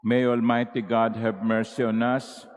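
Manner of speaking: ordinary talk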